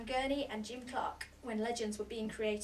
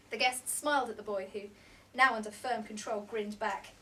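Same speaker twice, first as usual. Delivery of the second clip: ordinary delivery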